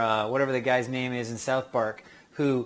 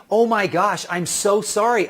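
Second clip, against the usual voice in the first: gushing apology, pitched up; apologetic; 5 semitones higher, 5 dB louder than usual. Acted. Visitor breaking the tension with someone busy, apologetic. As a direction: apologize emphatically, a bit louder. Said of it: "as soon as you apologize like that".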